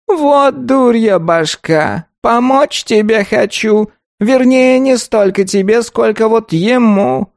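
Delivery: high, slow, grumbling golem; a scolding creaky tree creature. A narrator's reading.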